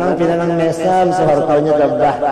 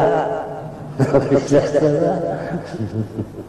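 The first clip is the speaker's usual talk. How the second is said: soft, trailing laugh; a quiet chuckle that runs on; 2 semitones higher, 6 dB quieter than usual. Real, not acted. Speaker to listeners after his own joke, soft laughing.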